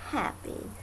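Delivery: ordinary delivery, nothing marked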